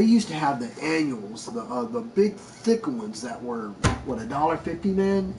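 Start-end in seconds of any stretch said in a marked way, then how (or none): none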